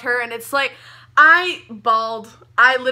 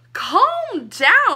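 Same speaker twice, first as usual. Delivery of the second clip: "Calm down." pitched much higher, pleading; higher, more exasperated; 9 semitones higher, 4 dB louder than usual. Pretend. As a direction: repeat it higher and more desperate. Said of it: "And I'm like".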